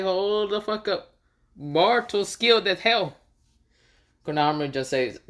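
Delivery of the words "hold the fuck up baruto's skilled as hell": shocked. Acted.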